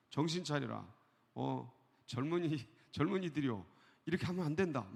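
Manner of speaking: normal talking voice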